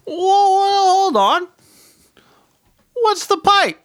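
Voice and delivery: high, drawn-out whoa of disbelief; astonished. Retold or imitated. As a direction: stop them short, high-pitched and incredulous. Acted astonished reply to odd question.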